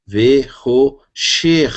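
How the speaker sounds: Ve-ro-sher stretched very slowly, each syllable apart. Shown as a demonstration. Teacher repeating the word slowly so the class can follow. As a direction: drag out Ve-ro-sher slowly for students. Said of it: "Então, fica Ve-ro-sher".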